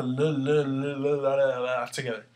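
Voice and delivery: repeated "l" sounds babbled in a string; tongue-tied l sounds. Shown as a demonstration. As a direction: rattle off a run of "l, l, l". Mimics clashing repeated l sounds.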